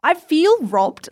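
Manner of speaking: slow, high, drawn-out complaint; feeling robbed. Retold or imitated. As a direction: stretch it out, hard done by. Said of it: "I was kind of like".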